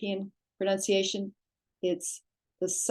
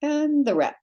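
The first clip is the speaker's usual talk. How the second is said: ordinary talk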